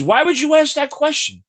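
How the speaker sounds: high mock-offended question; imitating host's indignation. Guest imitates host recoiling at his flirting, high and mock-offended. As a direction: play her taken aback, pitch jumping up. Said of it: "She goes".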